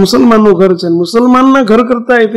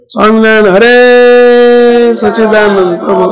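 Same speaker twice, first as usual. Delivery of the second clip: imitated public announcement; 2 semitones higher, 6 dB louder than usual. Monks announce over a car microphone in the society, loud devotional call. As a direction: re-enact the street announcement, drawing out the holy name. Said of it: "Mike મૂકીને સોસાયટીમાં જાહેરાત કરીએ".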